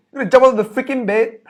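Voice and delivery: fast, loud and high, jumping out of bed; urgent alarm. Acted. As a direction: sound the alarm like the building's on fire. Urgent fire wake-up scene.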